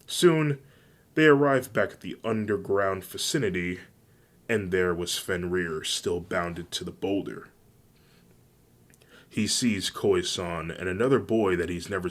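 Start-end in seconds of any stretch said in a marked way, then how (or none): none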